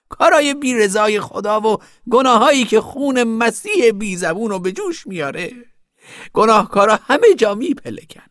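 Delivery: very high, loud preachy zealot; fanatical scolding woman. Acted. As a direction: condemn the sinners at a high, forceful pitch. Plays fanatical woman condemning sinners. (زن متعصب با صدای بلند و خیلی زیر)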